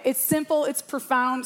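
ordinary talk

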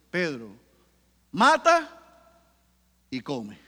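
voice of God. Acted. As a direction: command it from heaven. God's voice in the vision. (voz de Dios que ordena, "mata" alto y fuerte)

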